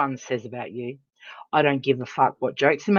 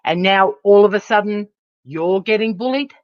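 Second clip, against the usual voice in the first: usual speaking voice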